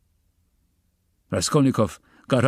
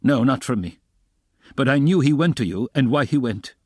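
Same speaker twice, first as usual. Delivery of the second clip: raised, emphatic retort; pointed, slightly heated reply; sharp answer; 6 semitones higher, 4 dB louder than usual. A narrator's reading. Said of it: "Raskolnikov answered sharply".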